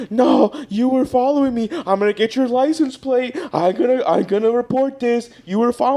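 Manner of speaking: a lightly voiced stranger threatening to report; indignant lady. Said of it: "she was like".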